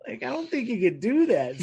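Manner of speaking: telling a friend about the ashes, high incredulous. Retold or imitated. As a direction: squeak out the disbelief.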